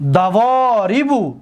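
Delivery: دواریبو as one word, slowly, with "vo" held long; teacher's drawn-out whole-word model of دواریبو; joined-up slow model. Shown as a demonstration. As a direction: stretch the middle of دواریبو without breaking it. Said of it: "دقت بتید".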